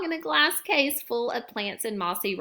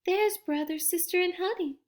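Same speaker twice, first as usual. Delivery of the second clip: excited granny; 8 semitones higher than usual. A narrator's reading. Granny spotting the cubs on stage, high and hushed. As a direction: point it out, higher and hushed. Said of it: "said Gran".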